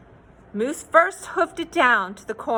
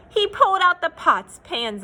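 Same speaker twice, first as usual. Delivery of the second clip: ordinary delivery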